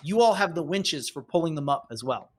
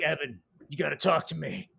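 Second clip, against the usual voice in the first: grizzled vet; 3 semitones lower, 4 dB quieter than usual. Pretend. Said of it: "the grizzled vet".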